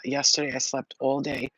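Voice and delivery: ordinary talk, nothing marked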